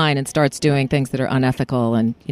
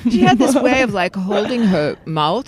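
French accent with the mouth pushed forward. Shown as a demonstration. Actress showing how her classmate held her mouth, French accent. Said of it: "If you start talking like that".

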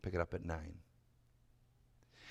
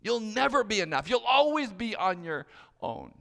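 loud, higher accusing voice; shouted condemnation; 6 semitones higher, 6 dB louder than usual. Acted. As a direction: pronounce the verdict loudly. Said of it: "statements that are shouted out".

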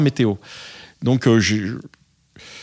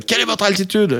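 raised-pitch imitation of a yelling controller; 8 semitones higher than usual. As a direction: mimic the tower shouting the question. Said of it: "j'ai entendu hurler". (contrôleur qui hurle, imité plus aigu)

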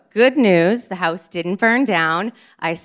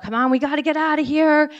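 urgent call; 7 semitones higher, faster than usual. Retold or imitated. Urging children out of fire.

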